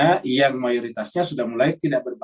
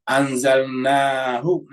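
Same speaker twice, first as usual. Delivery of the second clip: Quranic recitation; much slower than usual. Shown as a demonstration.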